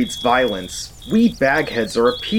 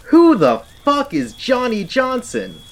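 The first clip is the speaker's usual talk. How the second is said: exasperated shout; 11 semitones higher than usual. Acted. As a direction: yell it out in disbelief. Outburst of confusion.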